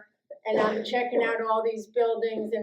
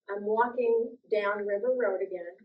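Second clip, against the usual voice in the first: normal talking voice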